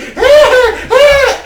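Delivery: very high, loud squealing laugh; squeaky creature cackle. Acted. Half-gnome laughing at the crowd, squealing cackle. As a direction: cackle like a shrill little gnome.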